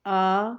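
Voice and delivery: آ held long and open; long vowel demo. Shown as a demonstration. Teacher showing the long vowel to pupils. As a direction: stretch آ out to show its length. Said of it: "اگر میں پڑھتی ہوں".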